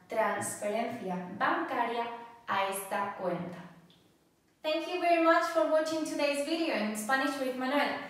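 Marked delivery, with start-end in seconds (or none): 4.6-8.0 high, loud and fast sign-off; bubbly channel outro asking for likes; upbeat outro. Acted. Host thanks viewers and asks them to subscribe, bubbly and fast.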